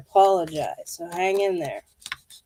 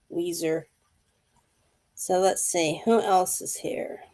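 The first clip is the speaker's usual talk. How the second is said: ordinary talk, nothing marked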